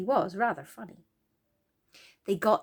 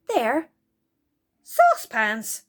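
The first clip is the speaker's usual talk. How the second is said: sudden discovery; 3 semitones higher, 8 dB louder, slower than usual. Acted. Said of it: "said Franny suddenly".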